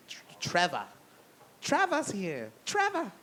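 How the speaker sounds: put-on old lady Boston accent calling. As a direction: call out Trevor, Boston style. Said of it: "thickest fucking Boston accent".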